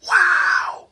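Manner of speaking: sudden high shout of wow; startled wow. Real, not acted. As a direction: cry out in shock. Startled at dark room.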